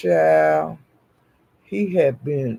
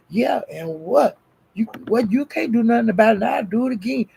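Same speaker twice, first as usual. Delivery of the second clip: imitated brazen challenge, getting higher; defiant comeback; 6 semitones higher, faster than usual. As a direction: dare her, mimicking his defiance. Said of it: "talking like that".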